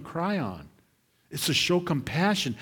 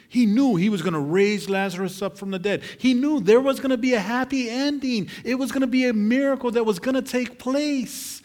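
higher and louder, ringing out the verse; full-force preaching; 9 semitones higher, 6 dB louder, faster than usual. Acted. Preacher proclaiming Romans twelve to the church, full-force preaching. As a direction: preach it to the back row.

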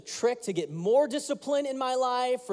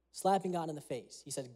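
usual speaking voice

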